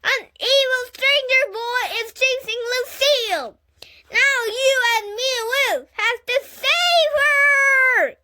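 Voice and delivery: rally your friend to the rescue; heroic shout. A narrator's reading. Junie B rallying Grace to save Lucille, high loud shout.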